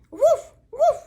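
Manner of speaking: very high, loud yappy woofs; two forceful squeaky barks; mama dog barking. Acted. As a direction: bark it out big and high.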